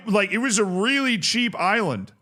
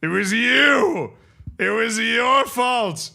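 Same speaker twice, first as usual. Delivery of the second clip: slow, dramatic blaming; mock accusation; 5 semitones higher, slower than usual. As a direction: point the finger theatrically. Blaming chatter playfully.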